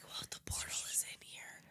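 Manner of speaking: hushed mock-secret whisper. Imitating investigators' whisper meeting, hushed whisper. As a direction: whisper like a huddled conspirator. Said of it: "they have a little whisper meeting".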